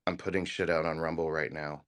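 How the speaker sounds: ordinary talk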